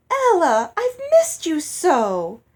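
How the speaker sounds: high, loud and drawn out gushing; gushing greeting. A narrator's reading. Hattie to Ella at the ball, a gushing syrupy greeting. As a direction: overdo the delight to fool her.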